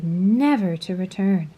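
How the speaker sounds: plain reading voice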